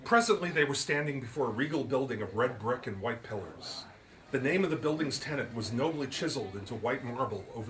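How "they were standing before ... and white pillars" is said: usual speaking voice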